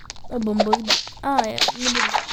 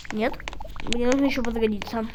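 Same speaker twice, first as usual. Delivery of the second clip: normal talking voice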